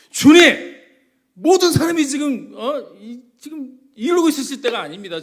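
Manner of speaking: 짜증 섞인 큰 소리로 스승을 재촉하듯; loud, high, exasperated urging; irritated shouting. Retold or imitated. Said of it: "라고 소리를 치고 짜증을 낸 거예요". (짜증 내며 소리치는 베드로)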